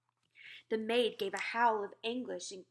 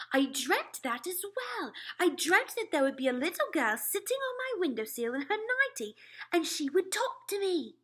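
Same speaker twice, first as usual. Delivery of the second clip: amazed; 9 semitones higher, 6 dB louder than usual. A narrator's reading. Queen amazed by dream.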